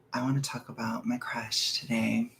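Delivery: secret whispered confession; whispering a secret to the chat. Real, not acted. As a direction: lean in and whisper it. Confessing about crush.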